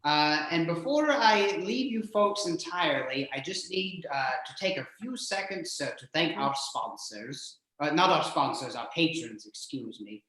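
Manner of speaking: ordinary talk, nothing marked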